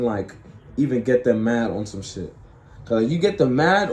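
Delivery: normal talking voice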